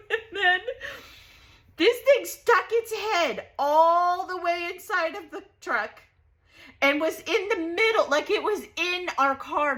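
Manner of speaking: excited story of the ostrich in the car; worked up. Real, not acted. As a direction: get worked up all over again. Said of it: "I'm getting worked up".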